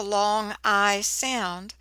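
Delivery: long I sound drawn out slowly; slow, deliberate teacher stress on long I sound; slow emphasis. Shown as a demonstration. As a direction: stretch out long I sound for the class. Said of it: "syllables that end with the".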